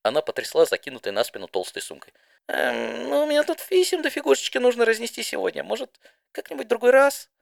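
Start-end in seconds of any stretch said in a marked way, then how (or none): 2.5-7.3 much higher, hesitant and squeaky; high shy pony voice, evasive. Acted. Didi to Spitfire, nervously avoiding the race, high squeak. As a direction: dodge the question with a nervous squeak.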